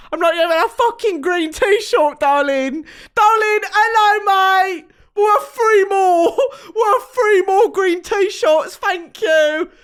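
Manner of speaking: put-on loud, high drunken Cockney shouting; mock Cockney British accent. Drunk woman mocking British men, loud Cockney accent. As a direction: bellow like a hammed-up Cockney at the pub. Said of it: "So, no, I'm talking like this".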